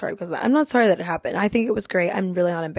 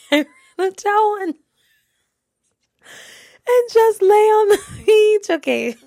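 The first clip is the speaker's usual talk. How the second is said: high, loud words collapsing into breathless laughter; laughing to tears; 11 semitones higher, 7 dB louder, slower than usual. Real, not acted. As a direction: squeeze it out through a real laughing fit. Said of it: "I'm about to cry".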